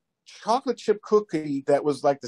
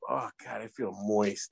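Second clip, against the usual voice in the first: low soft sigh, lower and softer than his chat; suggestive sigh; 7 semitones lower, 9 dB quieter than usual. Retold or imitated. Teasing the cruise friends, sighing it low and soft. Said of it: "all of a sudden I just go".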